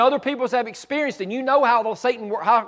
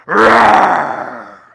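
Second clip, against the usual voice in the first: put-on loud playful roar to call kids; a big growling roar; lion roar; 9 dB louder than usual. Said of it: "I'll just make this noise like".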